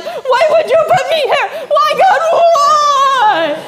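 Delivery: high, loud laughing whine at God; mock whiny complaint. Believers complaining to God, mock whiny shout. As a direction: protest like a stuck child. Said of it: "We're like".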